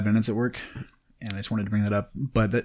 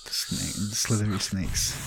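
soft slow slithery hiss; snake voice; 6 dB quieter, much slower than usual. Pretend. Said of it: "That's a really good snake impression".

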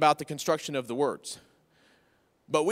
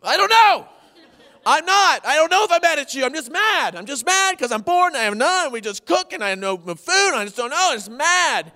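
much higher and louder, hammed-up whine; whiny mad husband; 9 semitones higher, 7 dB louder than usual. Pastor acting out whining to his wife, hammed-up. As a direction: whine like a sulky bored kid. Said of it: "I'm like".

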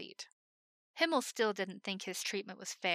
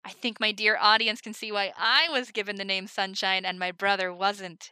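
showman patter; 8 dB louder than usual. Acted. Himmel teasing her brother before the audience, loud showman voice. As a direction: play to the crowd.

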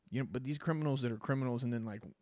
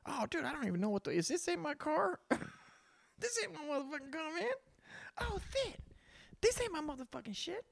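a caught car thief feigning surprise, much higher; playing dumb. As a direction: act innocent as if you got the wrong car. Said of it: "said that in real life".

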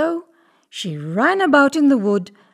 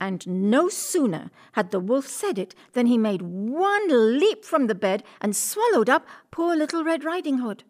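ordinary delivery, nothing marked

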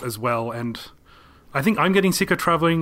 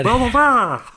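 chipmunk babble; 9 semitones higher, 4 dB louder than usual. Pretend.

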